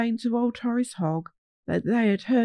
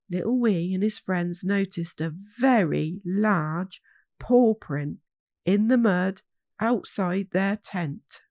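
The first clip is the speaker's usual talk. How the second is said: ordinary delivery, nothing marked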